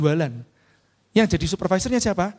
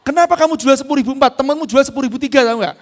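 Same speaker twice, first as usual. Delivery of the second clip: rapid scolding; 6 semitones higher, 6 dB louder, faster than usual. Retold or imitated. Scolding overpriced seller kid.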